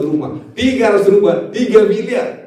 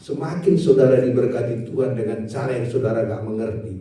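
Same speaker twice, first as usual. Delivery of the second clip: ordinary talk, nothing marked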